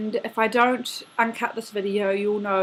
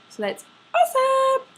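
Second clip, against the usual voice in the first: much higher, jokey squeal; squeaky mock cheer; 3 dB louder than usual. Vlogging to her best friend, a high joking squeal. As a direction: pop the line up into a silly squeak.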